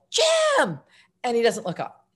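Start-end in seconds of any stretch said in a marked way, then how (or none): 0.1-0.8 very high, drawn-out call; retold high-pitched holler; yelling down. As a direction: call the name down to someone far below. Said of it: "So I yelled down".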